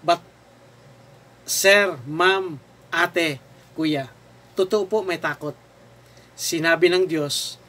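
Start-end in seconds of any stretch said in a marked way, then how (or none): none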